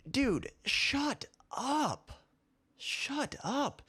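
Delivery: high, drawn-out exasperated plea. Real, not acted. Host to his critics, exasperated. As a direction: stretch it out, pitch leaping up in disbelief.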